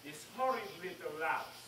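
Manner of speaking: very soft, menacing verse; quiet witch's spell; hushed incantation. Acted. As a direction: recite a curse over the victim. Witch recites spell over boy.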